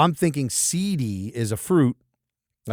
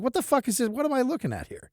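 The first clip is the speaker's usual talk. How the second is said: high-pitched retelling of bewildered shock; baffled disbelief; 12 semitones higher, 5 dB quieter, faster than usual. Retold or imitated. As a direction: replay your own baffled reaction, pitched way up. Quotes own baffled reaction.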